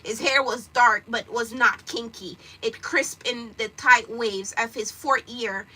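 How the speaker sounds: slow, loud, measured read-aloud; slow deliberate storytelling; story narration. Acted. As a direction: read the novel to listeners steadily. Reads a novel aloud.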